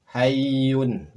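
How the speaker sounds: "Hayyun" loud, doubled y held; model Arabic reading. Shown as a demonstration. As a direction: sound out "Hayyun". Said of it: "Berikutnya silakan". ("Hayyun" dengan ya bertasydid)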